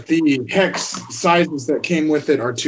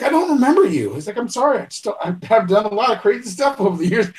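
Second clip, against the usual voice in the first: much higher, fast, sheepish movie voice; movie character quote; 7 semitones higher, faster than usual. Retold or imitated. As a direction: play the confused guy, rushing through.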